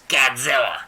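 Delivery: loud raspy dub shout; old smoker voice. Retold or imitated. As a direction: yell it like a muffled old smoker. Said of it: "He's got, like, a smoker voice".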